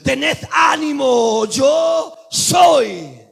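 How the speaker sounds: loud, slow full-force preaching; preaching climax. Acted. Preacher exhorting the church, full force. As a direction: shout the hope to the church.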